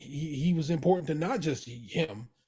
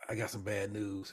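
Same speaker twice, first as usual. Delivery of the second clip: much softer and lower; grim hushed news; 6 semitones lower, 10 dB quieter than usual. Retold or imitated. Jimmy quietly warns his uncle, low and hushed. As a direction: break the bad news under your breath.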